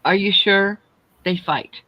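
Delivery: ordinary talk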